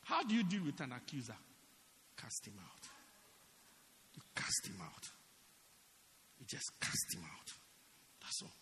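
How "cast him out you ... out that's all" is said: ordinary delivery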